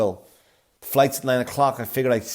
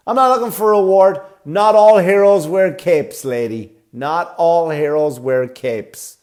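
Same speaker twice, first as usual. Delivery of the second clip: a hint of grandiose self-praise; lightly hammed noble speech; mock smug hero; 5 semitones higher, 5 dB louder than usual. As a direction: ham up the humble hero bit.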